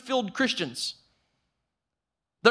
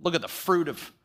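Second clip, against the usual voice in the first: much lower, lightly voiced God admiring; mock God voice; 12 semitones lower than usual. Pastor mocking God admiring buildings, low mock voice. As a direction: drop low and play a smug God. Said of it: "He's not sitting around going".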